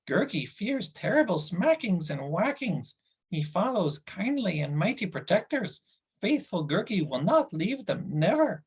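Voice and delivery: a sulky beast complaining about no food; whining creature Gurgi. Acted. Narrator voices Gurgi.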